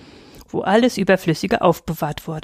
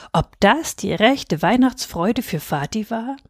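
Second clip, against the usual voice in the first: ordinary delivery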